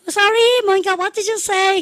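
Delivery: very high, slow questioning voice; imitated star asking the crowd to repeat. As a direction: pitch it right up and stretch it. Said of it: "[name]가".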